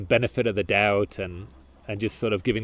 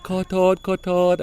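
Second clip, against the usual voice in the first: high, fast, overly polite mock apology; the other drivers apologising too politely; 6 semitones higher, faster than usual. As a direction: mimic a sweet, rushed Thai sorry. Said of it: "smiling and all polite".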